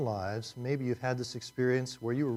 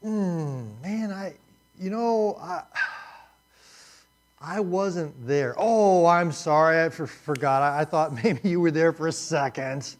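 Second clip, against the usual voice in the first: higher, louder, slow stammering apology; sheepish Job; 4 semitones higher, 6 dB louder than usual. Pretend. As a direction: squirm like a caught kid. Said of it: "what are you gonna say if you're Job".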